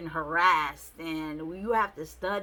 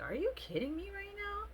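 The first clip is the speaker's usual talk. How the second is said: high, disbelieving squeal; incredulous; 5 dB quieter than usual. Retold or imitated. Disbelief at the date mocking her profession, high pitched. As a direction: act floored by the question. Reacting to his job joke.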